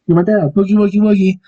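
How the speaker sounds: sudden sing-song silliness; playful silliness. Pretend. Sudden goofing, then apologizes for it.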